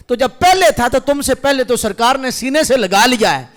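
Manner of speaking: loud, high rebuttal building to a shout; impassioned preaching. Acted. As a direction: argue back with mounting force. Preaches at rising full force. (پرجوش، بلند ہوتی خطیبانہ آواز)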